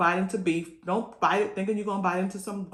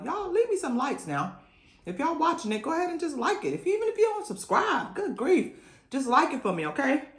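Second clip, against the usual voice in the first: playful plea; 9 semitones higher than usual. Real, not acted. Pleading for likes.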